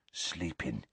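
very soft, low murmur; breathed. A narrator's reading. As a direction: breathe it out under your breath. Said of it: "breathed Hagrid".

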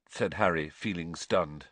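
ordinary delivery

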